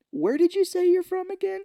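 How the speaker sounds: very high innocent query; high character voice; mock puzzled host. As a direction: ask with put-on wary politeness. Acting out suspicious kids' host.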